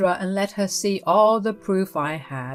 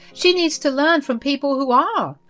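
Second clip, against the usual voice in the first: higher, sharp mother-in-law demanding answers; 7 semitones higher than usual. Acted. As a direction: play the meddling mother-in-law, higher pitched.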